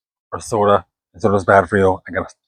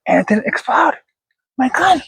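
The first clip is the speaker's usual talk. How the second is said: rising high with disbelief; amazed; 14 semitones higher than usual. Real, not acted. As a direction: squeal in surprise. Amazed the can didn't explode.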